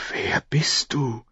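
slow, hushed whisper; awed whisper. A narrator's reading. Girl facing a man with a changed face, whispering. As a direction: whisper it slowly in astonishment. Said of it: "flüsterte sie".

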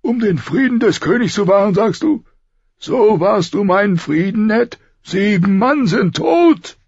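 high, forceful reproach; angry king. A narrator's reading. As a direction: accuse him in outraged disbelief. King angrily confronts Ned.

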